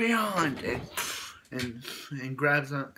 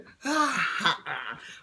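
high, crazed cackle; psychotic laugh; 6 semitones higher than usual. Retold or imitated. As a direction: laugh like a maniac. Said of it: "Wade just has a psychotic laugh".

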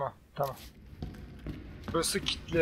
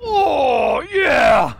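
higher mock shout of a heard voice; imitating a shout; 4 semitones higher than usual. Streamer imitating a voice heard in game, mock shout. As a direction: copy the strange yell he just heard. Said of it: "diye bir şey, ses geldi".

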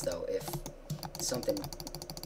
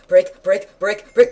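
loud urgent chant; desperate repeats; frantic; 6 semitones higher, 13 dB louder, slower than usual. Real, not acted. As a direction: hammer out each break. Frantic mining under attack.

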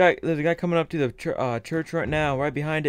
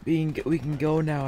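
ordinary talk, nothing marked

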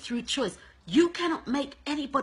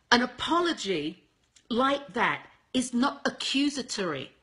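ordinary talk, nothing marked

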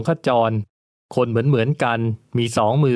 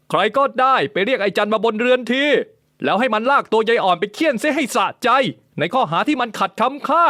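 angry master barking orders, pitched much higher; stern command; 11 semitones higher than usual. A narrator's reading. The nobleman ordering a servant whipped, stern and furious. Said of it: "คุณหลวงขจรกล่าวเสียงเข้ม".

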